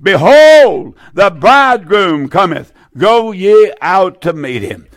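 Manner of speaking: loud, drawn-out "Behold", then easing; crying out. Acted. Preacher quoting the midnight cry, crying out. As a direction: proclaim it like a herald's cry. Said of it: "there was a cry made".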